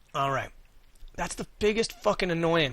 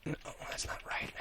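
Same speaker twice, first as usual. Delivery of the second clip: whispering; 19 dB quieter than usual. Real, not acted. Muttering to himself while building.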